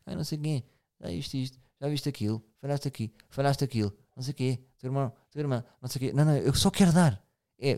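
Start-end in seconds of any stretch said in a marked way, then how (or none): 0.1-6.1 mock nagging parent. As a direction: whine the complaints like a guilt-tripping parent. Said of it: "Eu não quero dizer".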